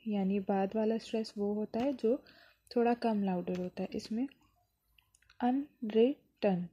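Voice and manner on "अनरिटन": "अनरिटन" very slow in three separate beats; syllable by syllable. Shown as a demonstration. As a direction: break it up as अन… रि… टन. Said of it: "टन इसमें primary stress है". (अनरिटन का हर syllable अलग-अलग)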